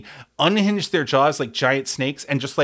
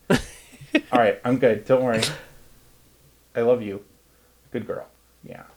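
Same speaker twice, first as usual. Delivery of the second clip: soothing the cat; faster than usual. Real, not acted. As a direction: hush and comfort a pet. Comforting his cat.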